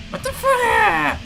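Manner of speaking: stretched-out, slow what the fuck; dazed slow repeat; slow disbelief. Real, not acted. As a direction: drag each word in stunned disbelief. Repeats it, drawn out slowly.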